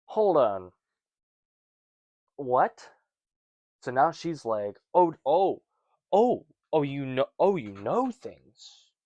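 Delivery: loud, high oh oh, then a slow awed finish; stunned realization. Retold or imitated. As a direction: gasp in disbelief then slow down. Woman realizes Jesus knows everything.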